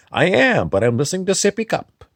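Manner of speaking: put-on very high, fast childlike voice; chirpy little high voice; high squeaky voice. Switches to a high channeled voice.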